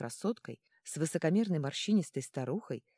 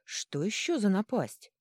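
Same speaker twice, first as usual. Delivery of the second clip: slow, louder, higher exclamation; indignant; 5 semitones higher, 5 dB louder, slower than usual. A narrator's reading. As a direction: draw it out in mild outrage. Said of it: "возмутился Ёжевиш". (возмущённо, с растяжкой)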